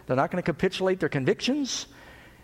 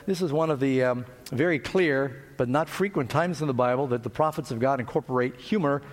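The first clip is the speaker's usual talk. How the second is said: ordinary delivery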